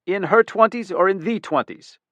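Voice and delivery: sharp, high, loud demand; irritated boss snapping at a young detective; snapped. A narrator's reading. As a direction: bark the question at the kid. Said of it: "The chief of detectives snapped".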